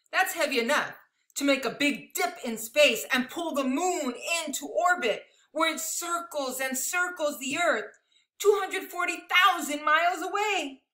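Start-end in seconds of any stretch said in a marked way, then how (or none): none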